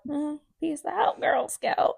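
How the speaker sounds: raspy smoker lady voice; smoker's rasp. Pretend. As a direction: talk like a cig hangs from your lips. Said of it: "With a cig hanging out of my mouth".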